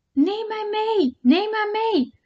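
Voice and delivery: high, slow ghostly chant of the lights; drawn-out spooky calling voice; eerie spirit call. A narrator's reading. Lichtjes roepen in het bos. (hoge, trage spookachtige roep)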